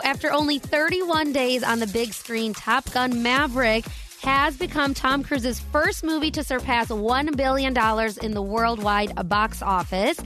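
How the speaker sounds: normal talking voice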